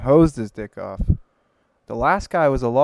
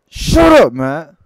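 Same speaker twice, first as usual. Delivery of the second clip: sudden high, loud shout; yelling shut up; 5 semitones higher, 15 dB louder, much slower than usual. Real, not acted. Snapping at Matt to stop interrupting the joke, loud shout. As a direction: bark it out, cutting him off.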